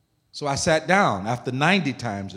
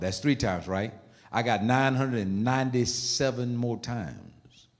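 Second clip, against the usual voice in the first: usual speaking voice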